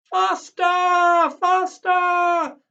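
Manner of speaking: very high, loud thrilled screech. Acted. Granny hurtling down the Big Dipper, high loud screech. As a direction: screech it on the ride. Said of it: "screeched Granny".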